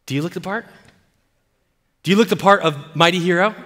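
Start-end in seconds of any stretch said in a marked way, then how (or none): none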